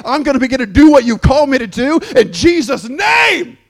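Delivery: high, loud preaching climax; rousing shout. Real, not acted. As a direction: build the crowd to a roar.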